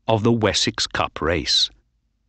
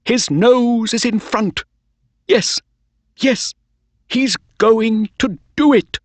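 cheering; 13 semitones higher, 4 dB louder than usual. A narrator's reading. Owner cheering his horse to win.

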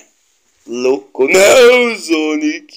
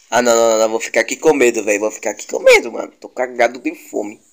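ordinary delivery

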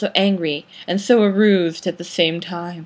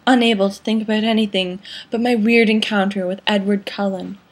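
plain reading voice

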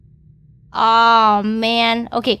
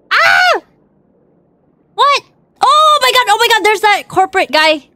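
startled shriek; 10 semitones higher, 6 dB louder than usual. Real, not acted. Startled spotting a corporate guy.

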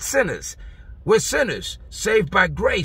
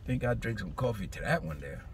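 soft, low, winding down; coming down after preaching; 8 semitones lower, 9 dB quieter than usual. Real, not acted. Preacher catching breath after the sermon peak, quiet and low. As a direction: settle back quietly after the rush. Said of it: "That was exciting".

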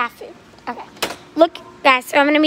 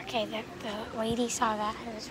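whispering; 15 dB quieter than usual. Real, not acted. Hiding from store employee.

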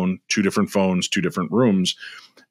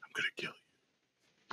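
barely audible imitated ghost whisper; whispery threat; 15 dB quieter, much faster than usual. Playback of the voice on tape, whispered threat. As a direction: breathe the threat out in a hushed whisper. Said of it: "Whispery voice".

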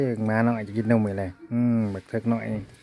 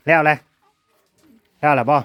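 loud call; 3 semitones higher, 7 dB louder than usual. Real, not acted. Loud exclamation at the baby.

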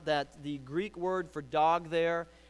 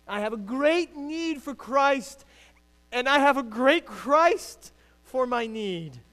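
loud, high, slow preaching of the quote; preached with force; 8 semitones higher, 8 dB louder, slower than usual. Shown as a demonstration. Preacher driving the Spurgeon quote home to the congregation, loud and slow. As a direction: proclaim it slowly and loudly. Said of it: "You got that?".

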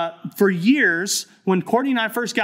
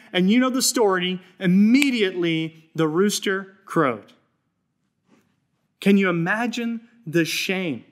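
ordinary talk, nothing marked

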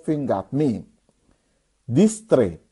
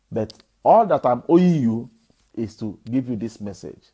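ordinary delivery

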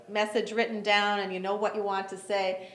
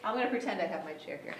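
ordinary delivery

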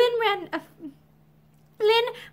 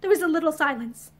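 plain reading voice

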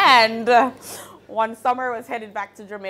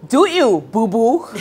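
louder, punchy quote of a friend's motto; Mike's motto, called out; 7 dB louder than usual. Retold or imitated. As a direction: call out his catchphrase. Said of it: "something that Mike always said to me".

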